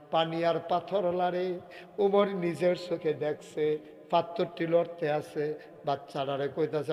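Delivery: normal talking voice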